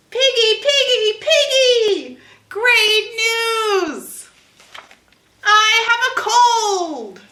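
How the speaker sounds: high, loud laughing joy; overjoyed. Acted. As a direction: shout the happy news. Gerald's joy at the news.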